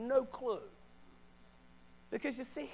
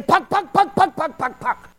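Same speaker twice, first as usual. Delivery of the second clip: pastor's puck puck; 6 semitones higher, 12 dB louder than usual. Retold or imitated. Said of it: "He began to say".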